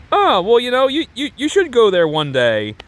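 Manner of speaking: high, slowing mock-oblivious reply. Man answering her travel hint, playing oblivious. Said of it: "not really taking the hint".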